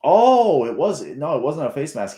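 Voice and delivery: sudden surprised correction; surprised outburst. Real, not acted. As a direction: react out loud as the replay proves you wrong. Surprised by the replay.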